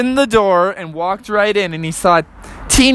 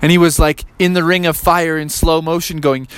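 ordinary delivery

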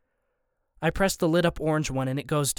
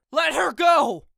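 loud high desperate scream; 13 semitones higher, 5 dB louder, slower than usual. A narrator's reading. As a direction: scream it, ready to attack. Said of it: "I scream".

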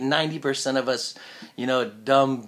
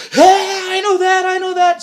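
loud, high shout of recognition; excited recognition; 11 dB louder than usual. Retold or imitated. Stranger recognising the voice from a TV ad, excited shout. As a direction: blurt it out, thrilled to recognise the voice. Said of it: "And they go".

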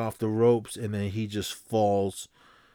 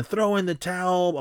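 mock scream; 8 semitones higher than usual. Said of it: "Duke is screaming".

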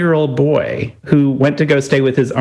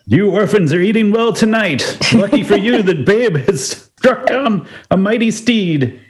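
higher, hammed-up old-timey announcer; mock announcer voice; 7 semitones higher than usual. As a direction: boom it out like a newsreel. Mock announcer voicing the nuns.

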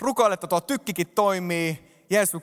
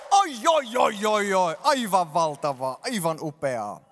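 delighted oohs; slower than usual. Real, not acted. Delighted at slide on screen.

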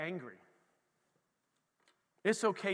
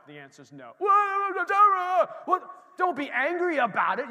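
1 mock parental outburst; 8 semitones higher, 8 dB louder, slower than usual. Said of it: "how many have had that situation".

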